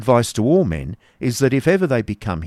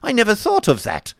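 very high-pitched realisation; startled cry; 13 semitones higher than usual. A narrator's reading. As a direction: cry it out high, suddenly struck. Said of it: "he cried, starting up".

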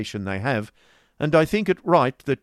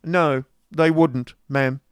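hasty interruption; 7 semitones higher, 9 dB louder, slower than usual. A narrator's reading. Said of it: "interrupted Ralph hastily".

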